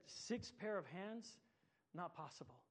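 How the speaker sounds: the angel can't believe it, slowly; quiet disbelief, six pair of hands drawn out. A narrator's reading. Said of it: "shook its head slowly and said".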